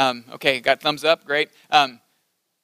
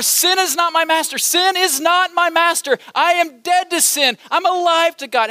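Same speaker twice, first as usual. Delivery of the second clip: higher, rousing chant-like repetition; repeated proclamation; 7 semitones higher than usual. Shown as a demonstration. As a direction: keep hammering the creed. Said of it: "say it over and over".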